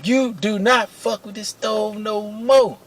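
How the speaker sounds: pissed-off manager's announcement, imitated. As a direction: lay down the law, word by word, in mock anger. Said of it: "J R made an announcement".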